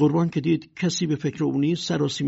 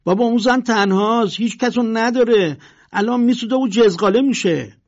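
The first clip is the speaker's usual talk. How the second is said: high, loud alarmed cry; urgent shout, higher and louder; 7 semitones higher, 7 dB louder than usual. Acted. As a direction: yell to the crowd that she's alone. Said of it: "یکی فریاد زد".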